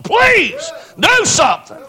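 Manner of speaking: high, drawn-out desperate cry to God; pleading cry. Retold or imitated. Preacher retelling how he begged God, crying out. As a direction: stretch the words out and beg. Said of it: "I was begging God to do something".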